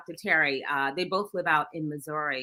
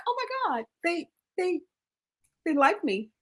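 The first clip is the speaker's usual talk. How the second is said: much higher, squealing wonder; giddy disbelief; 11 semitones higher than usual. Retold or imitated. Retelling her giddy delight at friends backing her. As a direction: gush like you can't believe it. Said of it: "I was like".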